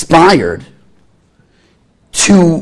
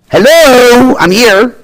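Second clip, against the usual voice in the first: higher, louder calling out "Hello, I'm here"; God calling out; 10 semitones higher, 6 dB louder, much slower than usual. Acted. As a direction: call out to get someone's attention.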